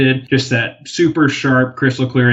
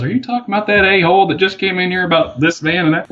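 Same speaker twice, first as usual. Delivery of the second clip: higher-pitched voice of a woman on the line; imitated towing lady; 7 semitones higher than usual. Towing company lady asks about the rude customer, imitated higher voice. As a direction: lift the pitch to play an incredulous lady.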